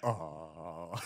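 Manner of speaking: low, soft mock-amazed "oh"; speechless amazement. Podcaster imitating Usopp stunned by his own shot, speechless amazement. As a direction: act dumbstruck with one quiet sound. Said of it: "he's, like, just as amazed".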